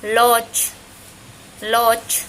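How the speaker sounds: "loch" loud, long vowel closed by CH; final Maya CH. Shown as a demonstration. Teacher repeating word for hug, "loch" loud and deliberate. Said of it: "Y suena diferente".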